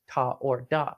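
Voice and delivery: ordinary talk, nothing marked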